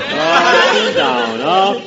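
slow sing-song, voice swooping up and down; imitating up and down talk. Shown as a demonstration. Mimicking Roger Price's sing-song voice for the panel. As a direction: roll "Up and down, up" like a roller coaster, slow and sung. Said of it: "with that up and down talk".

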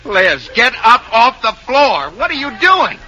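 high, alarmed scolding; exasperated demand. Acted. Husband orders wife off the floor, high and exasperated. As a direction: pitch it way up in disbelief. Husband appalled by wife's antics.

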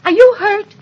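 high, loud frightened check; urgent, panicked concern; alarmed cry. Acted. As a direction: cry out in fear for your child. Mother after the oven explodes.

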